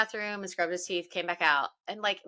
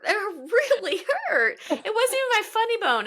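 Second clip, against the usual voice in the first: laughing through pain; 13 semitones higher, 3 dB louder than usual. Real, not acted. Mom who just hit her elbow, laugh-crying high. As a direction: laugh off the sting with a wince. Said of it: "That hurt".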